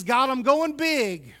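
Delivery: a firm shout of faith to God; bold declaration. Retold or imitated. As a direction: proclaim it like a man going big. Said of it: "He says".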